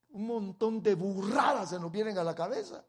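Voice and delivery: ordinary delivery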